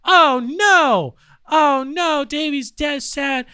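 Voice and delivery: high, mock-sad "oh no" from the mourners. Mourners at Davey's death, high mock sorrow. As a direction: sigh it out like a fake-sad friend at a funeral. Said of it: "and then everyone's like".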